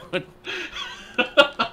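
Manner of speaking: sudden high cackle; high-pitched giggle fit; high squealing laugh. Real, not acted. Big laugh at teammate.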